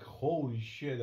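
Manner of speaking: usual speaking voice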